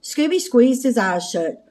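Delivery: ordinary delivery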